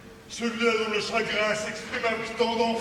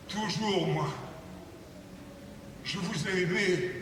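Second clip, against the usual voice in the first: wounded quiet reproach; 6 semitones lower, 11 dB quieter than usual. Acted.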